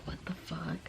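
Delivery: whispered what the fuck; whispered disbelief at the stalker. Retold or imitated. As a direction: breathe it out in a whisper. Inner reaction, seeing the stalker.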